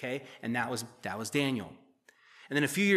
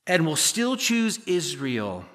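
the preacher leans slowly on "still"; stress on still; 2 semitones higher, 4 dB louder, much slower than usual. Shown as a demonstration. Preacher to congregation, stressing "still" slowly. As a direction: still drawn out slowly, falling at the end. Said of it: "God did it for emphasis".

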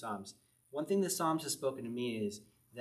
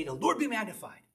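church call-out; 6 semitones higher, 3 dB louder than usual. Shown as a demonstration. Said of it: "What if we just say".

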